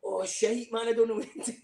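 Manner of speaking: hammed-up helpless fluster; mock panic. Soldier losing control of the crowd, mock panic. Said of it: "I was like".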